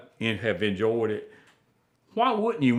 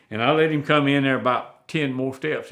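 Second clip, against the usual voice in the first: ordinary delivery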